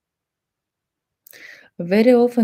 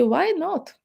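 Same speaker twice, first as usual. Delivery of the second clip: high playful agreement; coy yes; 10 semitones higher than usual. Pretend. Gym lady accepting the coffee invite, high and playful. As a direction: toss it off high and flirty.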